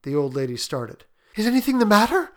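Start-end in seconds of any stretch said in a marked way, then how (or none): 1.3-2.3 anxious, higher and louder; breathless. A narrator's reading. Mother asking the visitor, breathless with fear. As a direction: ask in a breathless rush of dread. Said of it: "she asked breathlessly".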